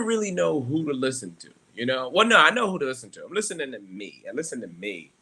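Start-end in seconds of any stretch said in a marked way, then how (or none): none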